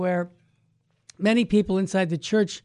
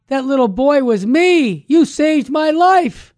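loud, high, amazed outburst; astonished revelation; 7 semitones higher, 8 dB louder, slower than usual. Acted. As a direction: blurt out the big reveal, drawing out the end. Priest reveals he was the boy.

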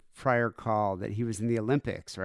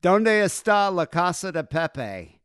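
slow, higher, louder tourist Spanish; mock clueless tourist Spanish; 7 semitones higher, 8 dB louder, slower than usual. Friend asking Spanish women about Pepe's house in loud, slow mock tourist Spanish. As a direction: drag out each Spanish word like a lost tourist. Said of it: "he would just go up to him and be like".

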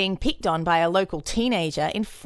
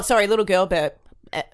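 ordinary delivery

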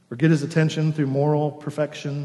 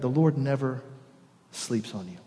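hushed emphasis; 6 dB quieter than usual. Shown as a demonstration. Preacher assuring the congregation, dropping to a hush. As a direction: let "The Lord never sleeps on you" sink into a hush. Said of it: "I want you to hear that".

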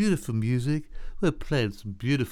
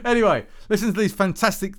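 laughing; 5 semitones higher, 3 dB louder than usual. Real, not acted. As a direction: still laughing, louder and higher. Laughs after joking about Dave.

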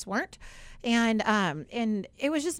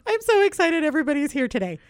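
excited boss; 6 semitones higher, 6 dB louder than usual. Retold or imitated. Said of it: "he goes".

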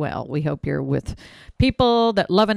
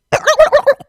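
turkey gobble; 12 dB louder than usual. Shown as a demonstration. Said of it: "I give you two for that".

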